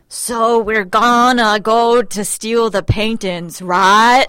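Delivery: put-on slow, overdone Boston accent; a clumsy actor's Boston accent; bad Boston accent. Mocking the actors' bad Boston accent. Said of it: "it was that bad".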